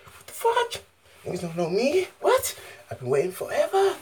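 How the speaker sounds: squealing high and loud, laughing; frantic high-pitched freakout; spazzing out. Pretend. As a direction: lose it in a high giddy shriek. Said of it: "Let me just spaz out".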